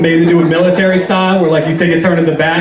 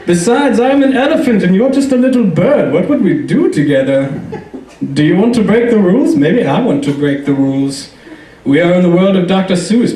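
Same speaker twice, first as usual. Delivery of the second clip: director playing elephant; 3 semitones higher than usual. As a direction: imitate an eccentric director hamming up an elephant. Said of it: "play the part of the elephant".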